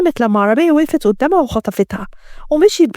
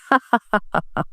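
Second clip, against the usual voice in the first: softer, mocking villain laugh; provocative laugh; 5 dB quieter than usual. A narrator's reading. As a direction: laugh to taunt him. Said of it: "ضحك كريم باستفزاز". (ضحكة مستفزة)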